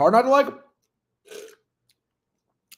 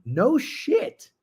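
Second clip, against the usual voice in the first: mock surprise; 12 semitones higher than usual. Mock reaction to pick.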